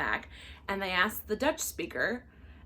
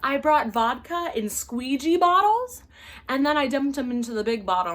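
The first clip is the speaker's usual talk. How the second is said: louder, mock innocent excuse; entitled passenger; 4 semitones higher, 8 dB louder than usual. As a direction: play the passenger who lies sweetly. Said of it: "She said".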